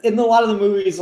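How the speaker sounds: ordinary talk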